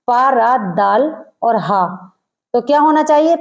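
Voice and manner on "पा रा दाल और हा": पा रा दाल और हा spelled out slowly letter by letter; name each letter of पर्दा slowly. Shown as a demonstration. Teacher spelling out पर्दा for students, slow and clear. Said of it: "अगर हम सही से पढ़ें".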